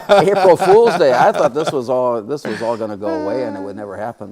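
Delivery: chuckling through the story of the award; amused laughing retelling. Real, not acted. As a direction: laugh it out, amused at the odd date. Laughing about April Fool's award.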